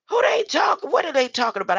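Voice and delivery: high, loud outburst; loud confused protest; indignant shout. Real, not acted. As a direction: shout it in disbelief. Outburst at verse.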